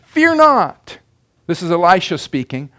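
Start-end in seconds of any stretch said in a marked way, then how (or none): 0.1-1.0 high, firm prophet's reassurance; a touch of a bold prophet; Elisha's command. Acted. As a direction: declare it like the prophet. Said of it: "And he answered".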